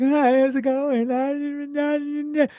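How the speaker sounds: high, drawn-out mock customer-service voice; fake-cheerful server greeting.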